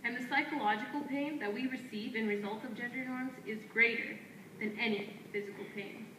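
firm, declaiming poetry lines traded back and forth; spoken word duet. Acted. Two poets trading lines on stage, in a firm declaiming delivery. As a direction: answer your partner line by line like a chant. Partner continues the poem.

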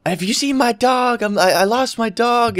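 squeaky worried lady calling out; mild mock scream for a lost dog; high mock panicked lady. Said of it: "I hear this lady screaming at me again".

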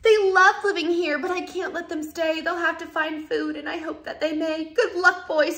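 the Lorax sends his barbaloots away, crying out. A narrator's reading. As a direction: call out sadly as the Lorax. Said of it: "he cried".

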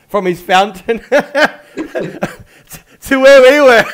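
loud, slow, through laughter; laughing while talking. Real, not acted. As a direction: speak through the giggles.

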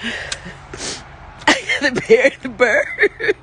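slow, high sobbing wail; breaking down in tears, slowly; crying. Real, not acted. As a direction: drag the words out through real sobs. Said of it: "is that why I'm crying".

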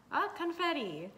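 a bright little gasp at the confetti; delighted surprise; surprised. Real, not acted. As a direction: react as the confetti pops up. Opening decision, confetti appears.

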